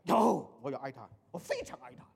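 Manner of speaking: 突然提高音量反驳; 像不服气的父亲一样顶回去; 大声辩白. Retold or imitated. Parent's defensive retort. (父亲不服气，大声说自己有爱)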